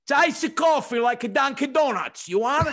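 loud, high mock Italian accent. Owner dumbs it down for the Irish guest, loud Italian accent. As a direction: blare it at an outsider in an Italian accent. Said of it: "Goes over to my Irish buddy".

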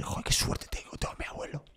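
hushed inner thought, barely voiced; whispered thought. Grandson recalling his thought at the wake, whispered. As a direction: breathe it like a secret to yourself.

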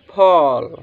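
"फॉल" with a long rounded aw, falling; model pronunciation. Shown as a demonstration. Teacher shows how to read it, "फॉल" with long aw. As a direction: sound out "फॉल" as a model word. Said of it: "तो इसको क्या पढ़ेंगे?".

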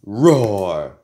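short, loud burst of a roar; play-acted angry roar; pretend roar. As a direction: fire back one defiant roar. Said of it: "Don't roar at me".

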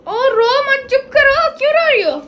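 high, loud pleading to stop crying; soothing scold. Real, not acted. To her crying friend, loud pleading hush. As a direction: hush her loudly, half laughing.

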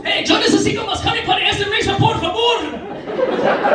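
high, hammed-up call in Spanish; Spanish-speaking chef. Chef calls to the kitchen for meat, high mock shout. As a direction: shout the order high, stretching the last words.

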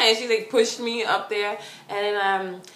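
usual speaking voice

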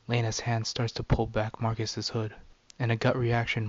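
ordinary delivery

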